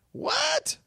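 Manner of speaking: sudden high squeak of disbelief; real surprise, pitch jumping way up; surprised what. Real, not acted. Host reacting to the director's name, startled high surprise.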